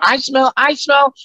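slow, very high drawn-out character line; squeaky, drawn-out comic sniffing-out line; movie catchphrase. Shown as a demonstration. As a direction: stretch each word in a squeaky goofy voice. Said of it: "And another part".

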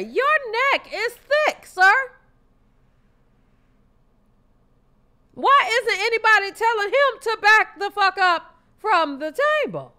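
high, loud mocking questions; mock outraged rant. Real, not acted. Co-host loudly mocking the comedian's eating habits, high and indignant.

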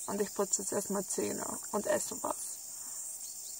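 soft whisper. Real, not acted. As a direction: whisper it, half asleep. Quiet morning aside, unvoiced.

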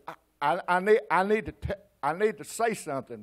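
halting, stammered attempt at an apology; choked up. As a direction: imitate struggling to get the words out. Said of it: "It choked up".

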